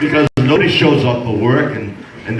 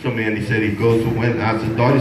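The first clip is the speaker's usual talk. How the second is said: normal talking voice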